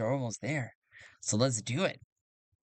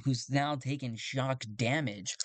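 normal talking voice

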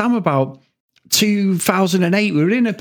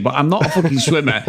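usual speaking voice

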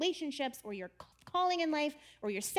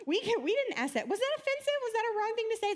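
much higher, louder, panicky questions; anxious crowd; 10 semitones higher, 3 dB louder, faster than usual. Retold or imitated. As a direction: fret aloud like a flustered crowd. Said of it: "And then everyone else was like".

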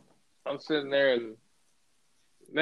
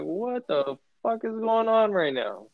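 higher, slow, mock-stunned question; retold disbelief; 6 semitones higher than usual. Peering over the fence at thirty strangers, slow stunned disbelief. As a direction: draw it out like seeing a stranger's party. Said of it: "And I'm like".

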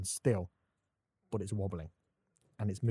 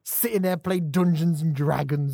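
loud, slow, raised-pitch jeer; scornful rant; 4 semitones higher, 6 dB louder, slower than usual. Real, not acted. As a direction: jeer at the nerds, drawing it out. Ranting at fantasy nerds.

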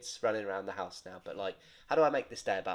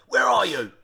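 loud, higher impatient call; shouting down phone; 7 semitones higher, 9 dB louder than usual. Retold or imitated. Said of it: "shouting down the phone".

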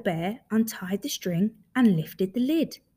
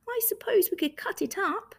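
higher-pitched small bear suggesting something; lightly voiced Little Bear; 11 semitones higher than usual. Little Bear suggests cutting the scarf, lightly voiced higher. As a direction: offer the idea in a little high voice.

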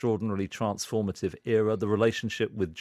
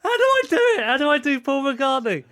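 high, loud and flustered; flustered laughter; 7 dB louder than usual. Real, not acted. Performer asking how to do the impression, high and flustered. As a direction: wail it through real giggles.

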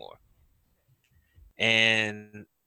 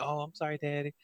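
much softer, a hushed little sorry; meek kids' apology; 5 semitones higher, 10 dB quieter, faster than usual. Retold or imitated. Kids apologizing to dad during his test, hushed and meek. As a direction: say it like a scolded child, hushed. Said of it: "they're like".

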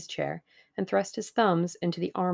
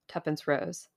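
usual speaking voice

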